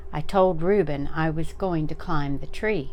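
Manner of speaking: ordinary delivery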